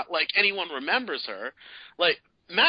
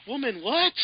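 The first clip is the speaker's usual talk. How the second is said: high, disbelieving squeak; baffled; 11 semitones higher than usual. Retold or imitated. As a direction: react like you can't believe it. Johnny baffled by her claim.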